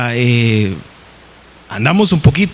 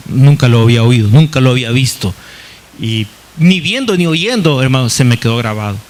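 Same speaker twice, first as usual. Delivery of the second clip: usual speaking voice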